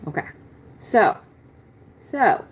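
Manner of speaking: usual speaking voice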